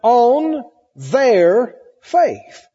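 each word drawn out very slowly; slow emphatic answer. Shown as a demonstration. Preacher to congregation, answering slowly and emphatically. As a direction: lean hard on faith, slow and deliberate. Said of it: "Where did he put the emphasis?".

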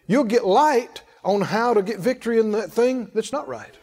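ordinary talk

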